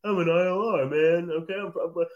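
mock Long Island guy, loose and cocky; imitating a cocky New York kid; Long Island bro voice. Teasing Cornell students by imitating a Long Island kid. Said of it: "I don't know why they're talking like that".

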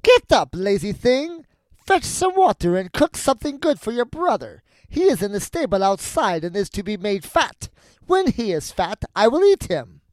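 high, loud, scolding witch; witch crying out. A narrator's reading. Witch shakes Gretel awake, high loud scolding. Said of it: "and cried".

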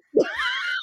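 a sudden high squeal of laughter. Real, not acted. Laughing at herself after forgetting her point, a high squeal of laughter. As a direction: burst into a high shrieking laugh.

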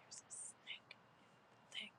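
tiny, nearly soundless whisper; hushed, trying to stay chill. Retold or imitated. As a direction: mouth it in a secretive whisper. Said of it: "trying to be chill".

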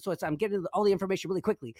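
quick chipmunk-speed delivery; sped-up podcast talk. Shown as a demonstration. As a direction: rattle it off fast like sped-up audio. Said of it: "talk like this".

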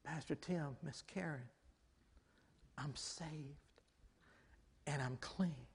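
very soft, halting confession; hushed, heartfelt. Retold or imitated. Mother confiding to pastor and wife that she's saved, hushed. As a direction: let each phrase land gently, nearly under the breath.

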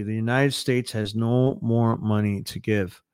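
ordinary delivery

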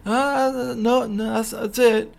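high exasperated protest; mock frustrated. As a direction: throw up your hands, high and fed up. Acting out the frustrated character.

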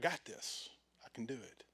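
whispered self-assurance; smug whisper. Retold or imitated. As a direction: breathe it like a cocky secret. Voicing smug self-confidence.

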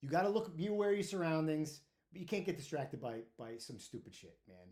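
ordinary talk, nothing marked

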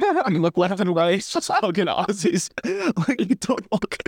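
high, giggling disbelief; cracking up while telling it; laughing. Real, not acted. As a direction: talk through the laughter, way up. Laughing disbelief about Aussies.

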